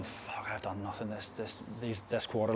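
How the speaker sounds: hushed, worried self-talk; muttered dread. Acted. Going into the quarterly event empty-handed, muttered dread. Acts out dread before the review.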